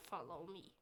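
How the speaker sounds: ordinary delivery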